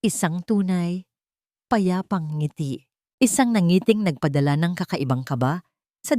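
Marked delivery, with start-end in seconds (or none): none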